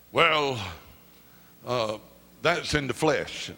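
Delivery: lightly voiced low, hesitant objector; a hint of a doubtful critic; disapproving critic. Preacher imitating people who would object, mildly disapproving. Voicing critics of lively praise.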